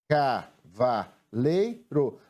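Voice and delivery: "Ca, va, lei, ro" in short separate beats; detached syllables, small gaps between. Shown as a demonstration. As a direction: count through the syllables one by one. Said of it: "Vamos separar agora em sílabas".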